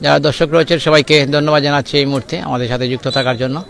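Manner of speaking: quiet. Real, not acted. Thanks viewers, voice drops.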